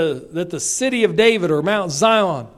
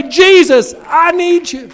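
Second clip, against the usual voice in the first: raised, urgent cry to Jesus; pleading prayer; 8 semitones higher, 8 dB louder, slower than usual. Acted. Preacher voicing a believer's plea to Jesus, loud and pleading. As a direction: call out to Jesus like a desperate believer.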